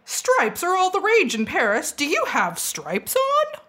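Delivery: high, slow, posh fashionable lady; posh role-play voice. Acted. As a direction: ham up a posh party guest. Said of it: "be silly and role play".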